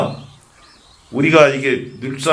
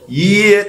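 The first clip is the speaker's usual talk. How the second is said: "이에" held high; stretched 이; 11 semitones higher, 6 dB louder, much slower than usual. Shown as a demonstration. Monk loading all attention into one syllable, high stretched "이". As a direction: lift and lengthen the "이" in "이에". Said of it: "이렇게 해야 되는".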